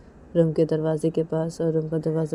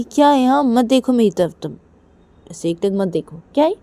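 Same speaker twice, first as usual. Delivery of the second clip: put-on higher, louder irritated girl; higher annoyed protest; annoyed Reshma; 6 semitones higher, 8 dB louder than usual. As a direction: snap at her to stop staring.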